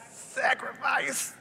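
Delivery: slow, voiceless, strained word; strained effort. Acted. Preacher showing praise can cost, straining on sacrifice. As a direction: squeeze it out slowly, without voice.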